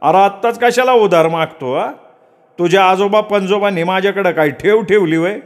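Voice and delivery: high, scornful shopkeeper snapping at a borrower; curt and rude. A narrator's reading. Shopkeeper refusing credit to Datta, curt and rude. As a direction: sneer down at him as you refuse credit. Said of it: "तुसडेपणानं तो दत्ताला म्हणाला".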